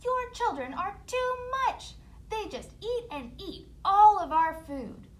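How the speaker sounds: very high, loud nagging; angry stepmother. Acted. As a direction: complain furiously about kids. Stepmother complaining.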